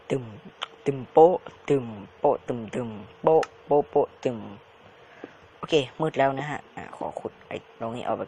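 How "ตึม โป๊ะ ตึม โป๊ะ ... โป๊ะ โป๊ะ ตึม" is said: loud thumping and popping noises; punchy mouth noises, tum and pop; mouth sound effects. Pretend. Gamer voicing blocks breaking, loud mouth pops.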